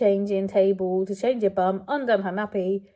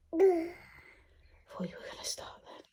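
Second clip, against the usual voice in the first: whisper; 8 semitones higher, 8 dB quieter, slower than usual. Real, not acted. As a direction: whisper it in relief, drawn out. Murmuring to baby after fright.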